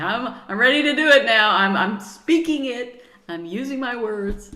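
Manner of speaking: loud, emphatic declaration; declaring it out loud. Acted. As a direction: proclaim your readiness to the universe. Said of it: "You're basically saying".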